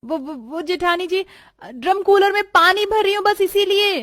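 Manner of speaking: high-pitched stammered call; stutter, then call it out high; flustered excuse. Acted. Bride excusing the shut door to sister-in-law, flustered.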